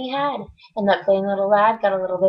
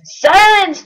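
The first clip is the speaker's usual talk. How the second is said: loud high furious bark; king barks at Mac to be silent; 10 semitones higher, 11 dB louder than usual. Acted. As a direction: shout him down at once. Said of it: "barked back".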